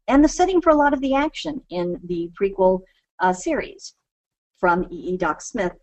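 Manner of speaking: ordinary delivery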